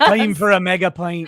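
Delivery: put-on Scottish accent. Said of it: "You need to say it in your Scottish accent".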